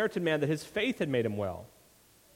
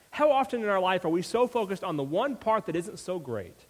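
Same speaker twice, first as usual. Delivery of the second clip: usual speaking voice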